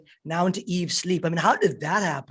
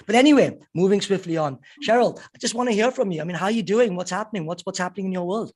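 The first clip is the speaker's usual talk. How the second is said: normal talking voice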